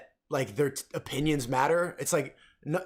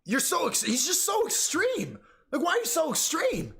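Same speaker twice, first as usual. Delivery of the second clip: whiny reactor; 10 semitones higher than usual. Retold or imitated. Mocking reaction-video creators, higher whiny voice. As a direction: whine like an offended reactor. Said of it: "and it's just like".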